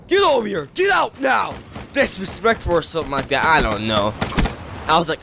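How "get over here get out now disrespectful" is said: high, shouted scolding; angry guard's yell. Retold or imitated. Security guard throwing a kid out of the game, shouting angrily. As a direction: yell orders like a guard fed up.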